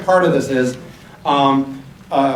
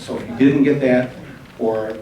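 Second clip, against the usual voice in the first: ordinary talk, nothing marked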